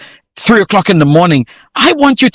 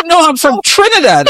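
retold protest; 11 semitones higher than usual. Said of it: "I said".

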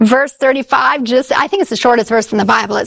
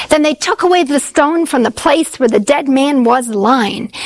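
ordinary talk, nothing marked

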